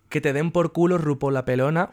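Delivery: mock insult. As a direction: fire it off loudly, half joking. Answer to what she told RuPaul.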